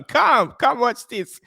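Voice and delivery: high-pitched, excited summons; calling girlfriend over. Retold or imitated. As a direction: call out to get someone over right now. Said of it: "I was calling my girlfriend".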